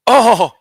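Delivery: loud, high cartoon "oh!"; one startled anime-dub exclamation; punchy exclaimed gasp; Speed Racer. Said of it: "here my impression is just this".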